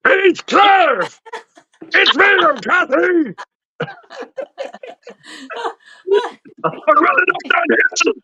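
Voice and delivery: mock [name]. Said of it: "[name]".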